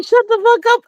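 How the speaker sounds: mocking her, pitched high; high mock quote. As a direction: mimic her, high and snide. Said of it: "she's saying".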